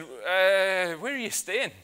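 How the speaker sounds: long drawn-out "uh", then a high question; unsure what to say. Acted. Flustered disciples answering Jesus, at a loss for words. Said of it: "didn't really know what to say".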